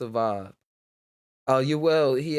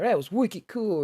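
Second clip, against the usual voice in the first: lightly imitated British accent, pitched up; 8 semitones higher than usual. Fan quoting the Brits' slang, British accent. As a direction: playfully quote British slang in their accent. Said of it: "love the Brits and how they talk".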